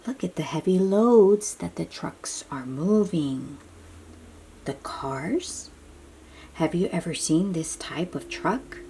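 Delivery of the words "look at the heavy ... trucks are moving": ordinary delivery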